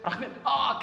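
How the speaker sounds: imitating an overexcited, impressed host; delighted surprise. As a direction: gush in mock amazement at a foreigner. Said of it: "our reaction for the foreigners is like this".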